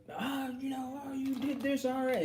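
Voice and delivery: high, squeaky mock rant; mock angry outburst. Husband flying off the handle at his loved one, mock angry high rant. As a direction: play a man blowing up in a silly high voice. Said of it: "and you're mad".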